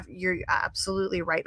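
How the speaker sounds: hushed, unruffled imitation; imitated calm boyfriend. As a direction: concede quietly to avoid a fight. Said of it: "be very calm".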